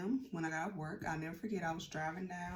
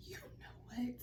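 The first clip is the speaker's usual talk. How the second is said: whisper; 4 semitones higher, 7 dB quieter than usual. Retold or imitated. Retelling tense phone moment.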